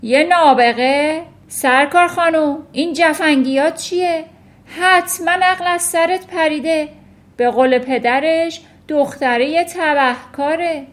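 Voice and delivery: loud, high-pitched indignant yelling; outraged shout. A narrator's reading. Headmistress rejecting teacher's word genius, outraged shout. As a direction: shout it in puffed-up disbelief. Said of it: "باد کرد و فریاد کشید".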